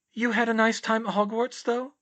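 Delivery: put-on young woman's wry thought; Hermione voice.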